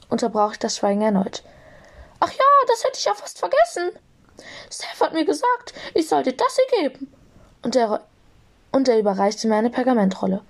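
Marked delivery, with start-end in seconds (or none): 2.2-7.1 high squeaky little voice; Flitty. A narrator's reading. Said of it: "entschuldigte er sich quiekend".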